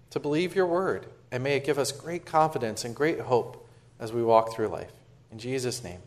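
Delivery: soft, hushed prayer voice. Acted. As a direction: pray quietly and humbly to God. Leads a prayer.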